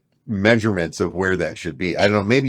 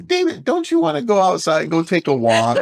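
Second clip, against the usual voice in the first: a light imitation of a sweetly gentle wife, pitched higher; 8 semitones higher than usual. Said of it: "very gentle".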